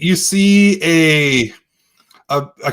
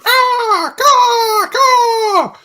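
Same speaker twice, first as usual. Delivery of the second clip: high squawking gull cries; mock seagull calls overhead; seagull caws; much slower than usual. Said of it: "seagulls kinda going".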